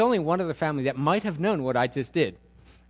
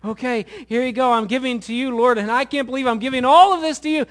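earnest prayer; 6 semitones higher, 3 dB louder than usual. Acted. Voiced prayer of giving.

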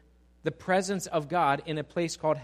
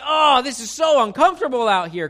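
put-on high, loud whiny baby complaint; newborn baby voice; 8 semitones higher, 11 dB louder than usual. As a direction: squeal it like a newborn fussing at the cold world. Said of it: "They're like".

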